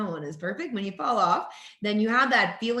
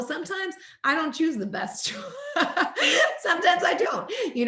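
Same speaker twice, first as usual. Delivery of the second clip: higher and louder, laughing through the words; laughing confession; 11 semitones higher, 3 dB louder than usual. Real, not acted. Admitting to the host she slips up, laughing. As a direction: giggle it out as you admit it.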